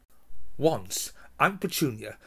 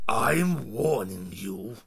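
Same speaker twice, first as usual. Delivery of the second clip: slow, menacing warning; a heavy uncle leaning in with a threat; slow stern threat; threatening uncle; much slower than usual. Acted. Uncle threatening Harry up close.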